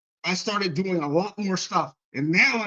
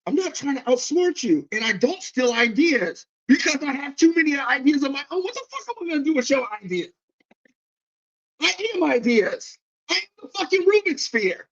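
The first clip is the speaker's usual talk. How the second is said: higher, fired-up self-defence; indignant rant; 8 semitones higher than usual. Retold or imitated. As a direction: talk yourself up, offended. Indignant reply to accusation.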